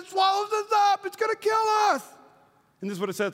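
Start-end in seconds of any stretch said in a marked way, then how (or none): 0.0-2.0 very high, wailing alarm; mock panic. Preacher as the fearful spies, high mock-panicked cry. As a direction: cry out like a frightened complainer.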